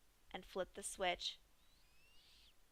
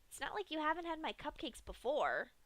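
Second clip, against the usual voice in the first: high, sweetly sinister pony voice; Pinkie's voice; 6 semitones higher than usual. Acted. Pinkie teases Dash about the cupcakes, high sweet voice. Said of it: "she contemplated".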